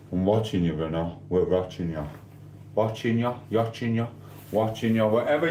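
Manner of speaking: ordinary talk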